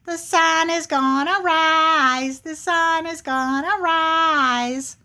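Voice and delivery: high, drawn-out singsong taunt; teasing little squirrel chant; smart-alecky squirrel. Acted. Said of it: "And the little squirrel".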